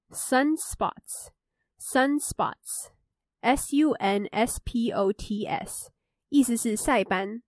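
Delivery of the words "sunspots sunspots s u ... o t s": each word said twice, then spelled out slowly letter by letter; slow vocabulary dictation. Shown as a demonstration. Host reviewing vocabulary for listeners, slow careful dictation. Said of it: "第三个就是sunspots".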